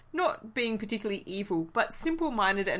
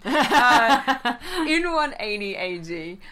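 laughing; 4 semitones higher, 8 dB louder, much slower than usual. Real, not acted. Talks through laughter.

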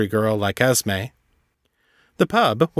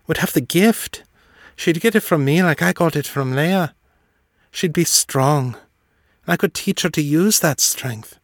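higher lilting voice, longing; Esme's voice, wistful; 6 semitones higher than usual. A narrator's reading. Esme telling John about her magic, higher wistful lilt. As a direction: let her yearning lift the voice higher.

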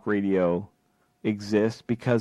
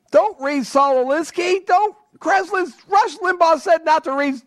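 much higher, louder, sneering put-on voice mocking talk radio fans; mocking conservative listener; 14 semitones higher, 4 dB louder than usual. Talk host mocks listeners who tune out Oliver Stone, high sneering voice. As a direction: squeal it like a scolding parrot of talk radio. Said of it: "we can all mock Oliver Stone and say".

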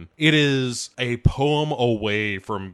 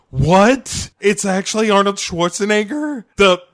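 loud, high-pitched disbelief; hammed-up astonished outburst; mock shock; 8 semitones higher, 6 dB louder than usual. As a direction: act out a stunned crowd, voice shooting up. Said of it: "like, shitting bricks".

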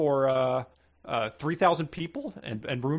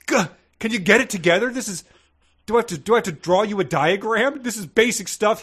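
higher, louder exasperated scolding; higher, louder, fed-up ranting; 7 semitones higher, 5 dB louder than usual. Real, not acted. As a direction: lose patience with the story. Exasperated rant at the character.

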